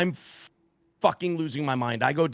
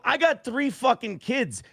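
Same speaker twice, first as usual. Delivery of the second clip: put-on raised, heated warning about his kids; retold stern warning; 6 semitones higher than usual. Father warning a guest about drugs, mildly heated. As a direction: lay down the law, a touch heated.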